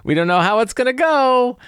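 high, doubtful mock voice for the others; worried naysayers. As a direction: lightly voice the hesitant colleagues. People doubting the weird release.

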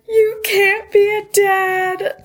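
high, slow crying plea; mock sobbing. Sister tells her brother he can't be a dad, in mock sobs. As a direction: wail it out like a sister in tears. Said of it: "I start sobbing".

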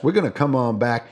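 ordinary talk, nothing marked

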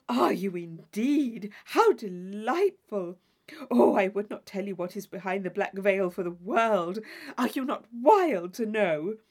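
loud, drawn-out delighted exclamations; thrilled Isabella. Acted. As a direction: squeal over the book with a friend. Isabella thrilled about the book.

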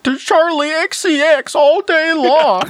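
mock campy voice. Host jokes as the commenter, in a high campy voice. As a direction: ham up a campy fanboy.

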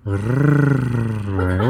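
a long trilled rrrr sound; just roll the R on its own. Shown as a demonstration. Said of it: "how I roll my R's".

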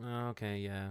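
underwhelmed reaction. Retold or imitated. Moviegoer watching a disappointing trailer, mock underwhelmed. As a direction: let it drop quietly, imitating polite letdown. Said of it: "you just kinda go".

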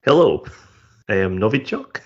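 hammed-up Russian accent; broad comic Russian accent; mock Russian accent. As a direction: play it as a proud Russian comedian in a thick Russian accent. Comic Russian guest persona.

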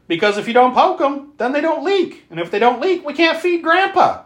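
imitating a little girl. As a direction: play the eerie child, sing-song and high. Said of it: "the little girl answers".

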